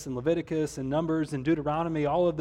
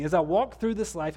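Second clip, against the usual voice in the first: normal talking voice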